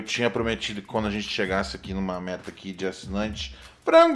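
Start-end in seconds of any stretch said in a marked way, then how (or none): 3.8-4.2 chamando alto. Real, not acted. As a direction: call out loudly, high and bright. Chama o frango para a webcam. (chamando o bicho bem alto)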